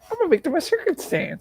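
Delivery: high, squeaky comic whine; Three Stooges impression. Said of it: "The Three Stooges".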